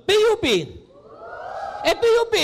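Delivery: shouted school name; loud call-out. Acted. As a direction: call out P U P loudly. Pastor names the school loudly. (malakas na sigaw)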